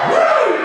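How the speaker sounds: sharp cheering whoop; a hyped crowd whoop; high woo. Real, not acted. Author whooping at the cheering kids.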